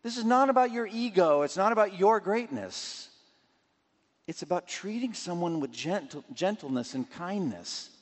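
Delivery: indignant rebuke. Acted. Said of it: "It's like".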